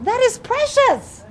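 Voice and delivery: high, drawn-out gush of delight; doting grandmother's gush. Retold or imitated. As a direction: coo it slowly, way up high. Gushing over granddaughter's reading.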